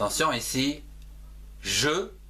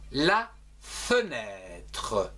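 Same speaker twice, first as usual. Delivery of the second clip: slow careful demo; much slower than usual. Shown as a demonstration. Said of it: "dans le mot".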